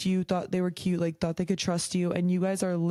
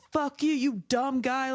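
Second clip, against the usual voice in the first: higher-pitched mock outburst at a rejection; mock yelling; 7 semitones higher than usual. Rejected person lashing out at the one who said no, mock yelling. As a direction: play an indignant, hammed-up telling-off. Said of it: "start yelling at them".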